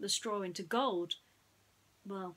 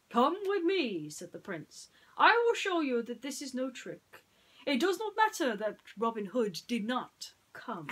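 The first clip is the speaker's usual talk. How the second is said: high, loud boastful prince; confident Prince John; 5 semitones higher, 7 dB louder than usual. Acted. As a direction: lead them on like a vain royal.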